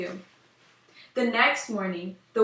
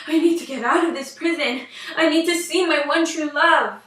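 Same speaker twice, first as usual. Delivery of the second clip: raised, louder, urgent longing; desperate resolve; 6 semitones higher, 6 dB louder than usual. Acted. Princess fleeing her parents' castle, urgent raised longing. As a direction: push the words out, desperate to escape.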